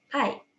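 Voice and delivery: Thai said as Tai; Japanese-style Tai, no h; Japanese reading. Shown as a demonstration. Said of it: "cuman depannya aja".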